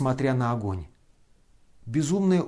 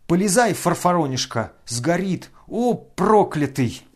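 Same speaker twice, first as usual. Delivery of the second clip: loud frantic yell, slower at the end; furious yelling; 5 semitones higher, 5 dB louder than usual. A narrator's reading. Ferdyshchenko yanking Ganya's sleeve, yelling furiously. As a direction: rage at him in a frenzy. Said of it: "заревел Фердыщенко".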